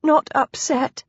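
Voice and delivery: lightly weepy wife's voice; tearful. Acted. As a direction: insist through acted tears. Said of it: "she burst into tears".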